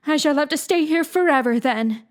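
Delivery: put-on mournful girl.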